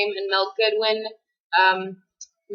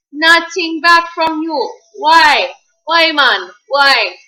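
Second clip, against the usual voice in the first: bad mock Jamaican accent, louder and higher; terrible Jamaican accent; 6 semitones higher, 9 dB louder, much slower than usual. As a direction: ham up a poor Jamaican accent in a rant. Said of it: "It's a terrible Jamaican accent".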